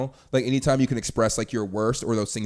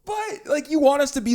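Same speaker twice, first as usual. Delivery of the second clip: exasperated squeal; 6 dB louder than usual. Real, not acted. Exasperated about double standard.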